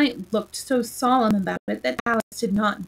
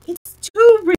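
higher, louder exclaim; cried out; 8 semitones higher, 8 dB louder than usual. A narrator's reading. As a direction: cry it out in disbelief. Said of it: "cried Alice".

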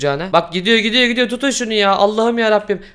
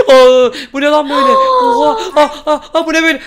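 panicked scream; 9 semitones higher, 6 dB louder than usual. Acted. Player attacked by a risen corpse at the grave, screaming in panic. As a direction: gasp into high, loud panicked cries for help.

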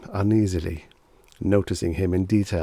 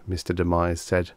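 ordinary delivery, nothing marked

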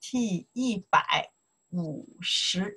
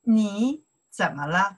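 model line for repeating; 4 dB louder than usual. Shown as a demonstration. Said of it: "Follow me to pronounce here".

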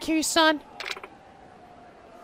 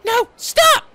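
loud, high desperate yell; shouting; 6 semitones higher, 5 dB louder than usual. Acted. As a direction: scream to stop the attack. Heroine yells to stop.

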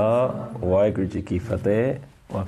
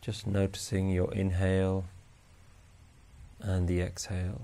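slow, soft, even meditation voice; guided meditation; 10 dB quieter than usual. Acted. Meditation teacher guiding listeners to notice each breath, calm and unhurried. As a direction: guide them gently through the breath with long pauses.

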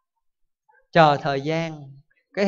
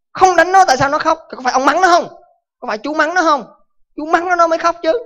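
high, loud, indignant accusation; angry father; 9 semitones higher, 3 dB louder than usual. Acted. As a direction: fire the questions at him in outrage.

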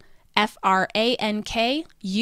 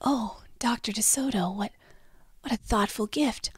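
demure, soft near-whisper; Miss Timid; 6 dB quieter than usual. Shown as a demonstration. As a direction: murmur it shyly with small hesitations. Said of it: "Miss Timid would say it like this".

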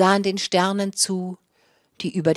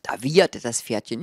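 ordinary delivery

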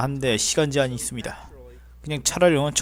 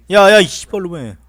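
angry yell; 7 dB louder than usual. Real, not acted. Cursing at game.

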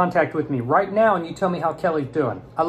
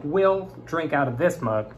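usual speaking voice

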